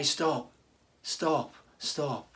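low, soft, firm stop with falling ends; quiet stop. Retold or imitated. Pastor showing how believers gently warn a brother, quiet and firm. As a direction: say it gently but firmly, like a loving rebuke. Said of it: "we are called to say".

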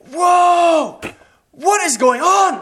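high, drawn-out shouted whoa; full-voiced imitation of a shocked shout; startled shouting. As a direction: yell it loud in shock. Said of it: "this guy just starts shouting".

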